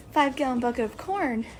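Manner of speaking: ordinary talk, nothing marked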